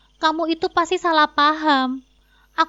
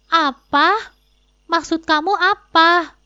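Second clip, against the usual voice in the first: loud startled "Apa?", then a slower demand; jolted into disbelief; shocked outburst; 3 semitones higher, 6 dB louder, slower than usual. Acted. Husband shocked his wife blocked the cards, loud startled demand.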